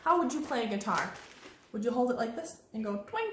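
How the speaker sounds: ordinary delivery, nothing marked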